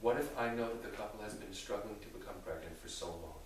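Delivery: ordinary talk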